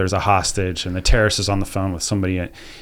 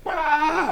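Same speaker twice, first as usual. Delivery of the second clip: high mock tantrum shriek; mock child scream; 4 dB quieter than usual. Imitating a four-year-old mid-tantrum, high shriek. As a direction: shriek it like a raging toddler. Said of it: "in their middle of their".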